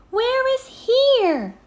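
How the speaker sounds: high, loud, drawn-out call. A narrator's reading. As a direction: Annie calls down to the pteranodon, loud high call. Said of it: "Annie called down".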